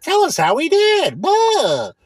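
high, slow sing-song mockery of the survey; high mock customer-survey voice, sneering. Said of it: "It's like".

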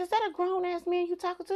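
high suspicious repeat; suspicious mom grilling. Retold or imitated. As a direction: press the son again. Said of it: "I said".

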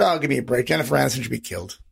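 low, offhand brush-off; low mumbled grumble of dismissal; throwaway dismissal. Retold or imitated. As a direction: toss it off like it means nothing. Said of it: "throwaway line".

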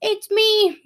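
put-on high little-kid voice; high-pitched child answering; Enoch. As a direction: imitate a small child piping up. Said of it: "I hear Enoch".

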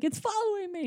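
high, hushed panic; freaking out. Retold or imitated. Emmett freaking out about the thing on his back, high and scared. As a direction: squeak it, scared and soft. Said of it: "And he's like".

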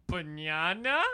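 loud, puzzled questioning rise; mock baffled coworker; imitating coworker. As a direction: echo the coworker's unsure "Banana?". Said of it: "He looked at me and went".

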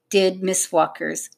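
ordinary delivery, nothing marked

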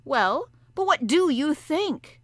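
the harpooner pressing the professor; pressing question. Acted. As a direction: demand an answer. Ned Land voiced.

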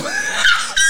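sudden squealing laugh. Real, not acted. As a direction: burst out in a high laugh. Loud laugh after joke.

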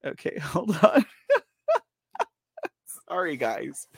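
giggling through words; laughing fit. Real, not acted.

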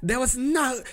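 high, indignant mock rant in a vaguely Russian accent; yelling in accent. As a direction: imitate an outraged foreign passenger yelling at staff. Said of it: "she was, like, yelling".

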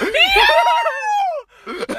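very high, loud meow shriek; screeched meow with a laugh in it; hammed-up squeal. Friend imagining getting a penguin as a present, squealing.

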